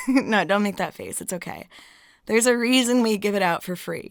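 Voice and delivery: ordinary talk, nothing marked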